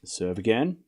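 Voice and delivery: low, quiet tennis commentary; live play-by-play. Acted. Calling the tennis rally for stream viewers, hushed commentator. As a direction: call each shot like a TV commentator.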